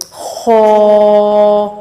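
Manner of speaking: خو said full and heavy, from the throat; خو with a full, heavy throat sound; heavy kho. Shown as a demonstration. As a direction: thicken the خو in the throat. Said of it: "اس کو موٹا کر کے پڑھیں گے".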